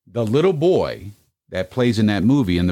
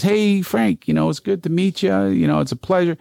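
high-pitched, gushing mock hello; effusive greeting; 11 semitones higher than usual. Ballplayer goes up to meet [name], effusive greeting. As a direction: play an eager introduction, voice lifted high.

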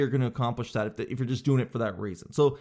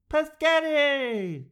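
very high, drawn-out mock toddler cry; little kid answering; 13 semitones higher, 3 dB louder, much slower than usual. Kid answering parent about dinner, high stretched squeal. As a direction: squeal the word out long and high. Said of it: "And then the kid answers back".

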